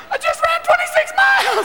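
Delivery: high, shouted strung-out runner; high-pitched frazzled marathoner. Retold or imitated. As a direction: squeal it, wrecked from the race. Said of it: "same look".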